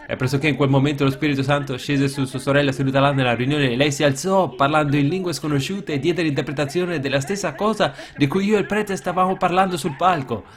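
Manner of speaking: ordinary delivery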